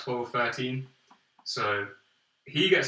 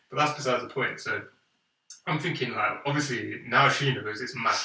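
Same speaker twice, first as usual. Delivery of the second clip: usual speaking voice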